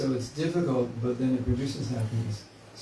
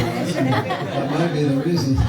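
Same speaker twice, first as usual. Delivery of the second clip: laughing; 7 dB louder than usual. Real, not acted. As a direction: joke while laughing out loud. Laughing at the temple joke.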